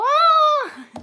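stressed outburst. Retold or imitated. Rich boss snapping under stress.

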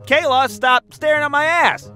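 high, proud declaration. Retold or imitated. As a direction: repeat it proudly, without a hint of shame. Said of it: "I said it proudly".

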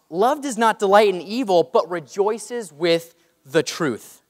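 loud, emphatic reading of the love verses, slowing at the end; scripture reading. Acted. Pastor reads First Corinthians to the congregation, emphatic and loud. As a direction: proclaim each line of the verse.

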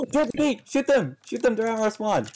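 sudden alarm at the enemy. Real, not acted. Enemy storming their spawn.

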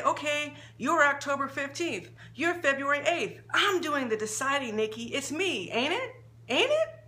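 lightly voiced mother, growing shrill; mother high on dope. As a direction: play the mother, sure she decides.

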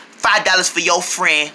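mock singsong girl talk; annoying girl. Boy mocking how girls talk, annoying singsong imitation. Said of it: "they talk so annoyingly".

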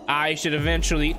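usual speaking voice

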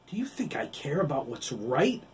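bitter shout at Oliver; angry outcry. Acted. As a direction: throw the question back in fury. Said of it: "Billy cried".

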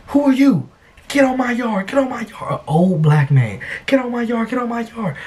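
put-on high shouty old man; threatening old man. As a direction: bark it like an angry old neighbor.

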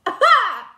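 high, loud burst of laughter; loud outburst. Retold or imitated. Outburst after the yelled revelation.

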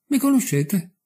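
the murderer blurts it out in shock; stunned, disbelieving question; startled exclamation. A narrator's reading. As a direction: pitch jumps up on Mi, a startled cry. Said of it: "esclamò l'assassino".

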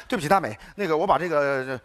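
smooth her down, voice dropped low; placating. Acted. Sudden shift to smooth, placating tone.

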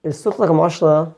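ordinary talk, nothing marked

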